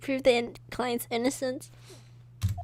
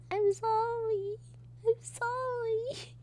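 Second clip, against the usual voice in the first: much higher and slow, drawn-out sorry; 13 semitones higher, much slower than usual. Acted. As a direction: stretch each sorry out, high and slow. Character apologises in dialogue.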